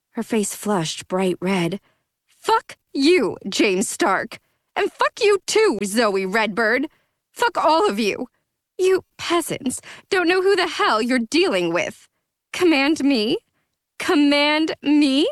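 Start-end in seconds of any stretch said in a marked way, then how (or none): 2.3-12.1 furious sneer. Acted. Drunk Aphrodite swearing at Stark and Zoey, furious. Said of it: "she sneered". 12.5-15.3 high, mocking disbelief, drawn out; sneering. Aphrodite mocking Zoey's command, sneering. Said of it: "she sneered".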